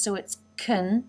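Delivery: "kun" said short and weak with a schwa, not "can"; reduced can. Shown as a demonstration. Teacher showing how "can" shrinks in fast speech, quick "kun". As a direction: swallow the vowel: "kun". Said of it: "the word can here is reduced".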